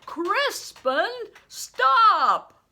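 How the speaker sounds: high, loud thunderous shout; a man bellowing at a fleeing boy. Acted. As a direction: roar his name to stop him. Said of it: "a thunderous".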